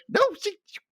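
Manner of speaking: high, incredulous sputter; exasperated high protest. Real, not acted. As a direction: squeak out the objection. Arguing with the show's scene.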